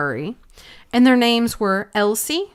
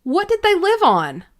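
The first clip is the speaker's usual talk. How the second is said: curious question; 11 semitones higher, 3 dB louder than usual. Acted. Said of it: "she's thinking".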